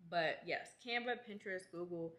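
usual speaking voice

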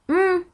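M as a held nasal hum, "nnn"; letter sound demo. Shown as a demonstration. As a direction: sound out M as the sound of Nun, no vowel. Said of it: "diye okunur". (M'yi "nnn" diye genizden uzat)

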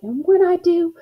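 high, punchy vow of revenge; snapped threat. A narrator's reading. Girl vowing revenge on her sister, snapped threat. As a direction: drive the threat upward in pitch. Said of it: "I snapped".

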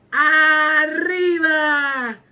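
long, loud drawn-out cheer; cheering shout. Acted. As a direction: stretch the word out in a loud shout. Lively count call-out. (grito largo y fuerte)

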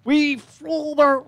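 high, slow little-creature voice; pebble voice. Acted. Pebble elder telling the party of the raid, high and slow. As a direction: speak like a tiny proud pebble. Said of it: "I can't use that voice".

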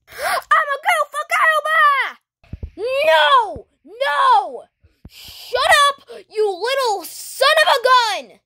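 high, hammed-up battle yelling; mock-furious high shouting; fight shouting. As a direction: holler in cartoon fury. Shouting match during the fight.

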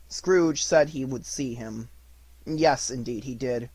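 ordinary delivery

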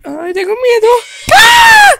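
high, loud hammed-up shriek; mock scared scream. Dog shrieks in fear at the horror video.

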